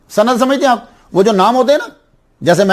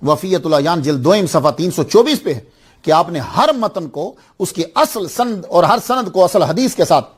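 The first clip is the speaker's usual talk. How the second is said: usual speaking voice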